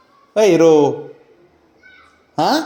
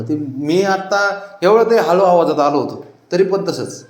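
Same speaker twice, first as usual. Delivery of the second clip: usual speaking voice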